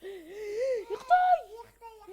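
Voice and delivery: gasping, high, loud cry of alarm; shocked gasp. Real, not acted. Gamer caught by the seeker, gasping shocked wail.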